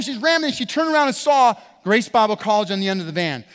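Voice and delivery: usual speaking voice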